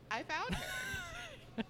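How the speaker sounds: high, slow triumphant cry; drawn-out triumphant exclamation. Acted. Triumphant reveal of finding her.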